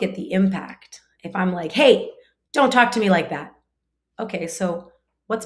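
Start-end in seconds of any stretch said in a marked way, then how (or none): 1.7-3.5 loud, raised, cutting parental rebuke; acted agitated scolding, higher and louder; sharp, agitated. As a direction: snap at the child in a demonstrated telling-off. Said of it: "my tone of voice gets sharp and agitated".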